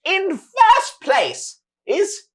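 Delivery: high, loud, slow showman cry; grand announcer. Acted. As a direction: boom it out to the whole arena. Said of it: "He says".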